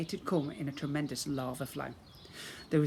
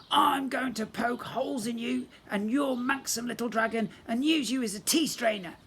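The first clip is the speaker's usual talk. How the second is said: screamed threat; 9 semitones higher, 4 dB louder than usual. Acted. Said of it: "the prince screamed at me".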